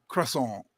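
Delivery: "croissant" as kruh-SAWN, silent T; French pronunciation. Shown as a demonstration. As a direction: say "croissant" the French way, nasal ending. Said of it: "If you're French, you say".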